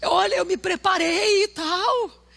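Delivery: hammed-up sobbing complaint to his pastor; mock crying. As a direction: ham up the crushed young preacher. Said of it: "desceu envergonhado, triste".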